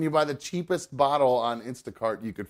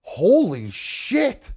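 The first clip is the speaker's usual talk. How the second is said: amazed exclamation; 5 semitones higher, 4 dB louder than usual. Retold or imitated. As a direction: play the guy who can't believe it. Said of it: "the guy next to me was like".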